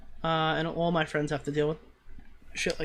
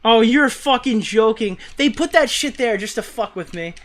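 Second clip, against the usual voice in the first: higher, louder indignant outburst; real exasperation; 4 semitones higher, 8 dB louder than usual. Real, not acted. Player accusing the game of screwing him, exasperated. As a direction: curse the game for tricking you.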